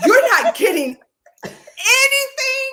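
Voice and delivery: high, loud incredulous question; shocked disbelief. Retold or imitated. Friend stunned Gloria buys nothing, incredulous squeal. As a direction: climb high on anything in disbelief.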